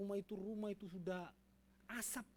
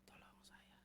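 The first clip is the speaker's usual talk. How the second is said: soft, low, fading whisper to God; whispered inner prayer; 6 semitones lower, 12 dB quieter than usual. Retold or imitated. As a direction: pray silently under the breath. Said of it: "Dalam hati saya".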